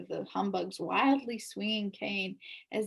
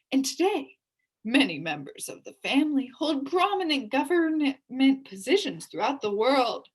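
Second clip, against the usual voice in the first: pompous boast; 5 semitones higher, 7 dB louder than usual. A narrator's reading.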